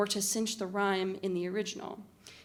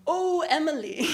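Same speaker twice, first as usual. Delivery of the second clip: high, laughing mock-poetic Oh, Emily; mock archaic call; 8 semitones higher, 6 dB louder than usual. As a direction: call out Oh, Emily like a stilted old poem, half laughing. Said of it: "call out to your friend in the cafe".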